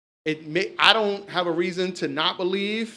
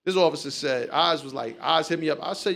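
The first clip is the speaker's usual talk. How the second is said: ordinary delivery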